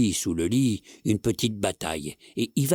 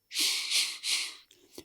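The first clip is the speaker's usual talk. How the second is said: unvoiced "shh" hiss, much softer; imitated cat whisper; 7 dB quieter, much slower than usual. Husband mimicking his whispering cat to his wife, whispered. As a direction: mimic a cat's whispering in breath only. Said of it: "et il chuchote".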